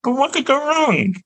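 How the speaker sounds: high-pitched mock optimism; mock confidence. Actor joking to podcast hosts about audiobook nerves, high mock-confident squeak. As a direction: squeak it out with false cheer.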